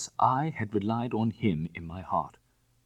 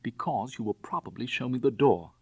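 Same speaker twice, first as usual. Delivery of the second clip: bitter, somewhat raised outburst; bitter cry; 5 semitones higher, 4 dB louder than usual. Acted. Bunny bitterly expecting Raffles to throw him out. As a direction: spit out the self-pity. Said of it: "I cried bitterly".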